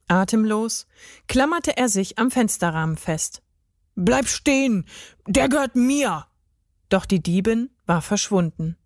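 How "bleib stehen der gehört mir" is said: higher-pitched call after a thief; urgent calling out. A narrator's reading. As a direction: call out to stop someone fleeing. Gerd calls after fleeing thief. (aufgeregt hinterhergerufen)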